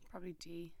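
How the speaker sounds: whispering. Pretend. Joking aside answer.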